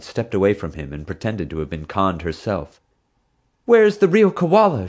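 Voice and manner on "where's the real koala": high, loud demand. A narrator's reading. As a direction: demand it, indignant. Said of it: "she demanded".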